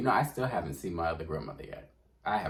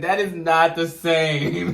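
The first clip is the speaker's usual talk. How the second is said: slow, high, laughing insistence; laughing protest; 9 semitones higher, 10 dB louder, much slower than usual. Retold or imitated. Telling grandma off, slow laughing protest. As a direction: protest while cracking up. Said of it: "I said".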